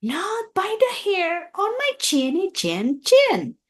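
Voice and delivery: high little pig voice; a small pig's high defiant cry. A narrator's reading. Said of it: "cried the second little pig".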